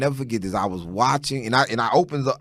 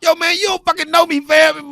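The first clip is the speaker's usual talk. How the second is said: mock offended comeback; 8 dB louder than usual. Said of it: "I'm like".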